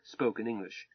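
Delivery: plain reading voice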